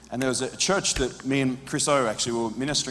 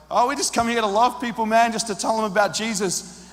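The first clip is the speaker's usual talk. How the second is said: much higher, eager and a bit nervous; nervous cheerful answer; 8 semitones higher than usual. Retold or imitated. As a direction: sound harmless and friendly. Nervously explaining to the man.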